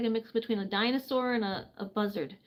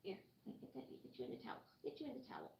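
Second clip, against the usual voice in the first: unvoiced soft murmur to the bird; whispered; 2 semitones lower, 21 dB quieter, much faster than usual. Real, not acted. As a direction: whisper while wrapping her. Wrapping bird in towel.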